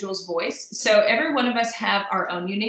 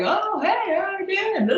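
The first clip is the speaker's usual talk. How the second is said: imitating the father-in-law's loud, much higher hello; loud booming greeting; 12 semitones higher than usual. Father-in-law greeting people in a hushed restaurant, loud and high. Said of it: "he is always like up here on loudness".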